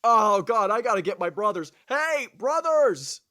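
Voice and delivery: high, loud cry for help; mock distressed calling. Blinded Cyclops summoning his brothers, hammed-up holler.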